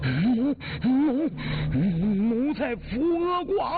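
high, tearful, broken stammer; quavering high voice, barely getting the words out; terrified stammering. A narrator's reading. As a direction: choke out each word in sheer terror. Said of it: "语不成声".

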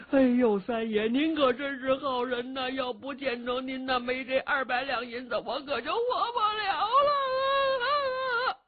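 hammed-up tearful begging; still crying. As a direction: sob it out behind a sleeve. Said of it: "程咬金还哭".

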